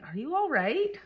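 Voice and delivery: high-pitched imitation of worried questioning; mock concern. Friends texting after her email, mock concern.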